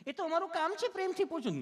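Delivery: high put-on nurse voice; sweetly asking nurse. Nurse insisting it is her job, high mock sweetness. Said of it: "તમે કેટલું પ્રેમથી પૂછો છો".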